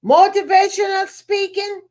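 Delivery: high, incredulous "oh" from the gentleman; a condescending gentleman's put-on surprise at motivational speaking; a retold snooty stranger, mockingly surprised; mock surprise. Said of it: "He said".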